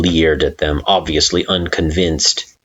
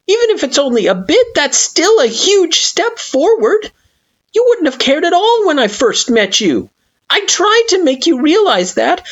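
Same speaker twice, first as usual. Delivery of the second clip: earnest, tearful plea; 4 dB louder than usual. Acted. Frisk earnest, emotional.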